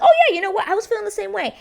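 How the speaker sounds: imitating a fake smooth talker, sugary and high; mocking the twins. Vlogger mocks the lying twin's reply, high and sugary. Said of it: "they'd be like".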